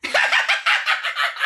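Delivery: very high villain cackle; shrill-pitched evil giggling on request; maniacal laughter demo. Shown as a demonstration. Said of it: "You do it".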